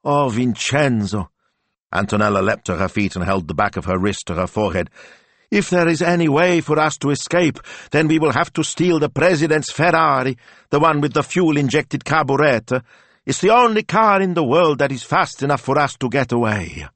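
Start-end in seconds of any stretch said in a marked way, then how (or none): 0.0-1.3 slow, high, hammed-up sigh of a lover's name; melodramatic actress. Antonella acting opposite her lover, melodramatic. 5.5-16.9 high, full-voiced declaiming; melodramatic actress. Antonella acting out her escape scene, melodramatic.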